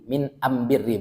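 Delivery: ordinary talk, nothing marked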